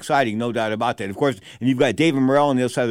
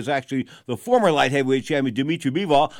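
ordinary talk

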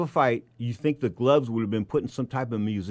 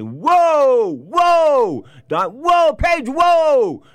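high, loud "whoa" shouts holding him back; imitating Don King; 14 semitones higher, 9 dB louder, much slower than usual. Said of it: "Don King said".

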